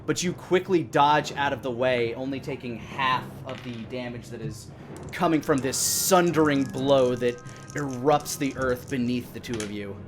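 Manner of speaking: normal talking voice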